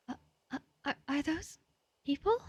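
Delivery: soft, high, halting question; frightened stammer. Acted. Character spotting figures hanging in the trees, frightened stammer. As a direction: stammer it out, rising high at the end.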